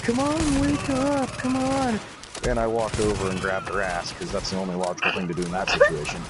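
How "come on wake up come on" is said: high-pitched pleading voice; imitated girl urging. Girl shakes passed-out friend, imitated in high urgent voice. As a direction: mimic a girl nagging someone awake. Girl shaking sleeper awake.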